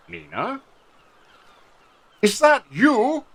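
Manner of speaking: high, slow, stunned recognition; joyful surprise. Acted. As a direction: gasp out her name as it dawns on him. Dragonborn recognizes old friend.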